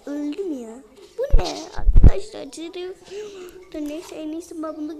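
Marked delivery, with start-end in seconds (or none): 1.2-2.5 higher, louder surprise; shocked cry. Real, not acted. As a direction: exclaim it in alarm. Shocked by game event.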